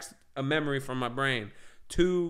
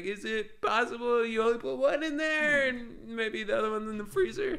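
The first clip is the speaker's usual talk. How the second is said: mock reasonable; 8 semitones higher than usual. Retold reasonable question to girlfriend.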